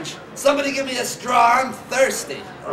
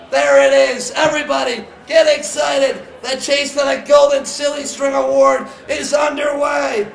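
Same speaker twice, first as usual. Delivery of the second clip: louder, rousing announcer's rally; excited announcing; 3 semitones higher, 4 dB louder than usual. Real, not acted. Host declaring the award chase underway, excited announcing. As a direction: build up the hype for everybody. Announcer rallies crowd as contest opens.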